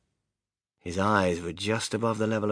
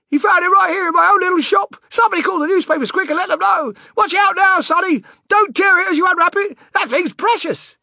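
frantic yelling; 10 dB louder than usual. Acted. Shopkeeper still yelling, overjoyed.

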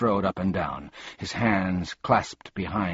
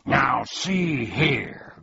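angry growl; 6 semitones higher, much slower than usual. A narrator's reading. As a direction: growl it out in protest. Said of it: "growled Tuck angrily".

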